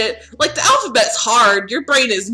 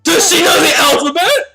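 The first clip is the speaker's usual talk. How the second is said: high, louder anxious repeat; insistent question; 6 semitones higher, 5 dB louder than usual. Acted. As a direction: demand an answer, flustered and high. Acts character insistently repeating question.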